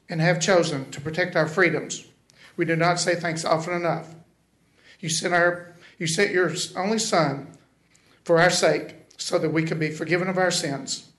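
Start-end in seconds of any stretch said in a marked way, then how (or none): none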